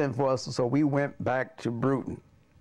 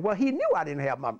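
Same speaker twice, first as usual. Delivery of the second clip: plain reading voice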